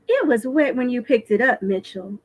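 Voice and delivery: ordinary delivery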